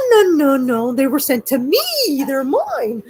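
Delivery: high, playful mock refusal; teasing. Teasing niece over the gifts, playful high. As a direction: tease the niece, keeping the gifts. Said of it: "And I was teasing her. I'm like".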